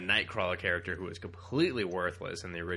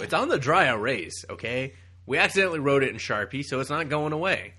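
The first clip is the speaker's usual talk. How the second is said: mock editor yell; 5 semitones higher, 8 dB louder than usual. Playing Marvel editor.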